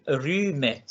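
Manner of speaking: roommate with a clear short u; pronunciation demo. Shown as a demonstration. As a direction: model roommate. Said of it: "sonaría room". (pronuncia roommate con u corta)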